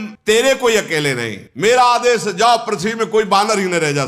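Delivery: mildly hammed-up furious order, pitched high; angry command. Ravan ordering his demons to clear the earth, angry command.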